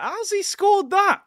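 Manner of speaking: high, slow, stunned cry; can't believe the goal went in; disbelief. Real, not acted. Streamer stunned as Salah heads in the goal.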